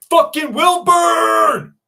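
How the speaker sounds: loud, high, drawn-out shout; hollered greeting. Real, not acted. Streamer greeting a viewer who turned up, hollering. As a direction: holler the name and stretch it out.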